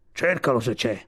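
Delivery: lightly voiced grumpy walrus; a hint of sleepy walrus grumpiness; gruff Sivic. A narrator's reading. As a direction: answer as a curt old walrus. Gruff walrus answers Kotick.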